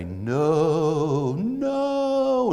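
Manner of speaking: soaring high, loud spooky wail; ghostly voice from beyond. Retold or imitated. Dead wife's portrait answering, a loud ghostly wail. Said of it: "And you hear this voice say".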